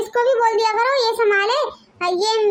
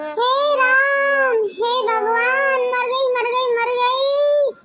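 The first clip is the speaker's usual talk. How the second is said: fake headache moaning; 3 semitones higher, slower than usual. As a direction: ham up a pretend headache, wailing slowly. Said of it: "क्यों चिल्ला रही है?". (बहाने से सिरदर्द का नाटकीय कराहना)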